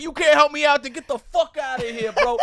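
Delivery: imitated loud, high-pitched angry shouting; angry dismissal. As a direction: act out a drunk man chasing someone off. Acted drunk man's angry order.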